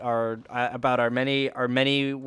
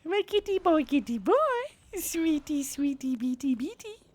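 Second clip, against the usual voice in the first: talking to pet. Real, not acted. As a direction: coo to the kitty, high and sweet. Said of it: "I was talking to my kitty kitty".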